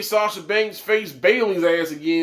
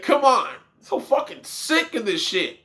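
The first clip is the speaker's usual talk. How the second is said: ordinary talk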